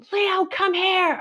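high, slow, urgent call; calling the dog. Retold or imitated. Calling dog near coyotes.

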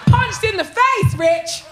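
loud, high, drawn-out outrage; indignant exclamation. Real, not acted. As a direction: exclaim it slowly in comic outrage. Exclaims indignantly about being punched.

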